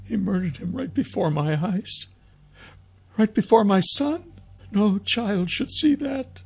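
high, tearful woman's voice; grieving mother on the verge of sobs; halting, high-pitched anguish; sobbing. A narrator's reading. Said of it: "she sobbed".

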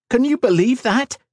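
very high, incredulous outrage; angry snap. A narrator's reading. Daughter appeals to her father, snapping indignantly. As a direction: snap in shrill disbelief. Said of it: "she snapped".

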